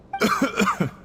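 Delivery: short wounded cough; pained coughing. Acted. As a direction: cough as if badly hurt.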